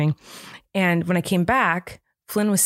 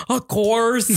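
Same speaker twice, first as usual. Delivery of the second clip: drawn-out mock grunt, higher; grunted retelling with amusement; imitating a grunt; 4 semitones higher than usual. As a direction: grunt the words as an impression, breaking into a laugh. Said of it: "he, like, grunted at me".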